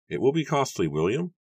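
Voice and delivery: high voice, lifting on the name; Matthew's character voice. A narrator's reading.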